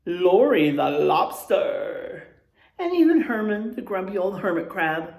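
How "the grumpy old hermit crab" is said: ordinary talk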